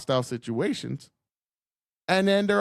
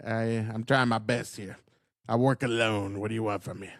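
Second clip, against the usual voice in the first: joking put-on whine; 6 semitones lower than usual. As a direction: play the beleaguered loner for laughs. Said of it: "I'm just messing with y'all".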